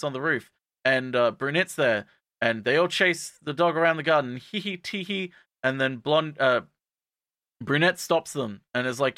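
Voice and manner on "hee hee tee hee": high, soft tittering; mock giggle. Girls chasing the dog around the garden, mock giggling. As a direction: imitate a cutesy giggle, high and small.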